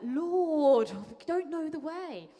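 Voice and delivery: loud, high, drawn-out Somerset complaint; Galilean Somerset accent. Acted. Thomas complaining to Jesus like an annoyed pupil, Somerset accent. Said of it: "the Galilean Somerset accent of like".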